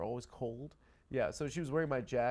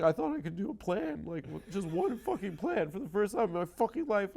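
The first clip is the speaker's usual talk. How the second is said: mock whine; 6 semitones higher than usual. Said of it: "I was like".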